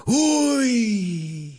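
high groaning uy; imitating Spanish uy. Host imitating a Spanish crowd's uy at a missed penalty. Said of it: "everybody went".

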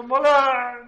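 teasing copy of a drawn-out yell; imitated microphone shout. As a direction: mimic someone yelling into the mic. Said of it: "como grita Lalo al micrófono".

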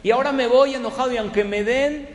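high, loud, threatening guest rant; retold angry shouting. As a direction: storm at the receptionist, full of self-importance. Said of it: "le empezó a gritar".

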